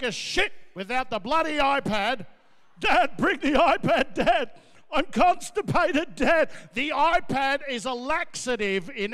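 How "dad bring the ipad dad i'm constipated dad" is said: put-on loud, whining child begging dad; whiny kid voice. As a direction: whine like a spoiled kid pleading. Kids begging for the iPad.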